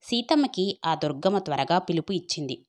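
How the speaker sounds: ordinary delivery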